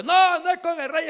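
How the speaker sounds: high, loud correction; shouted retort. Acted. David answering Abner from afar, shouted retort. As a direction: holler back a denial.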